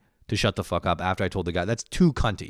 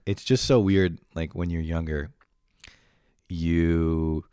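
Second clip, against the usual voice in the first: normal talking voice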